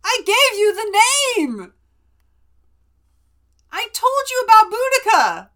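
loud, high, insistent protest; indignant outburst. Real, not acted. To her co-host who forgot, loud indignant protest. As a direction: demand credit for the name. Indignant claim of naming credit.

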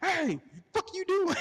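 high, loud mock yell. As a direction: holler at the intruder in a squeaky voice.

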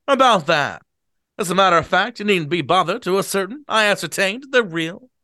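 put-on booming eager drunk; owl-eyed drunk. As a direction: show off the books to the guests. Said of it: "give Gatsby a bad voice".

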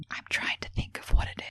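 quick hushed whisper. Pretend. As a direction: keep the whisper going. Continuing the whisper bit.

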